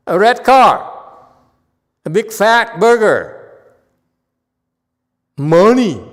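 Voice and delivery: slow, loud and high, savouring each word; a master savours the temptations he names, slow and loud; drawn-out loud listing. Acted. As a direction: stretch each craving out loudly. Master lists tempting things, drawn out.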